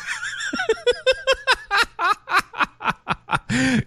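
long high-pitched laugh in rapid pulses; helpless high laughter; laughing fit. Real, not acted. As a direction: crack up in a high, drawn-out cackle. Co-host cracking up at the remark.

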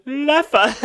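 high, loud mimicry of a friend; imitating friend. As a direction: blurt it high like your friend did. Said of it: "she was just sitting next to me and goes".

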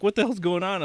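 freaking out. Retold or imitated. Husband imitates his startled wife, freaking out. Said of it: "freaking out 'cause she's like".

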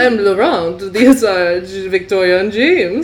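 loud, hammed-up French accent. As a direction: introduce yourself as a comic Frenchman. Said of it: "with the slightest of French accents".

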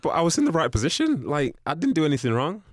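high, loud, defensive pleading; indignant protest. Retold or imitated. Player protesting to the manager.

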